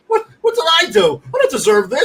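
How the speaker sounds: high-pitched imitation of a hurt victim; mock wounded protest. Offended birthday man protesting the roast, mock wounded. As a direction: protest in a high, comic plea.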